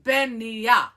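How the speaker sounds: "Benia" as ben-NEE-ya; correct Gaelic pronunciation. Shown as a demonstration. Host showing how it's pronounced, "Benia" as ben-NEE-ya. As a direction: say "Benia" with the middle stressed, nee. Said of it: "It's pronounced".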